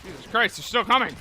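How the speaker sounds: high, loud panicked outburst; alarmed exclaim. Real, not acted. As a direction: shout in alarm. Enemies keep coming.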